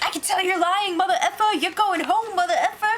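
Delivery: imitating Vanessa laughing as she yells at Steve; mock furious call-out. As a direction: ham up a furious housemate calling him a liar.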